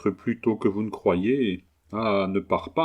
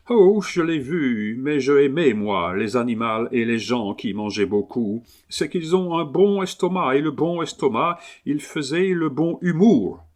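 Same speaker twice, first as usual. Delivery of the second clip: higher-pitched Englishman speaking broken French; English accent; 4 semitones higher than usual. Acted. Englishman haggling over his new dog, English accent. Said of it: "l'accent anglais".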